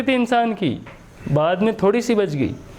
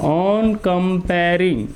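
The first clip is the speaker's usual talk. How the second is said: "On comparing" drawn out very slowly; slow dictation; 2 semitones lower, much slower than usual. Shown as a demonstration. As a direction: stretch out "On comparing" for note-takers. Repeats phrase slowly for writing.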